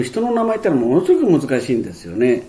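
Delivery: normal talking voice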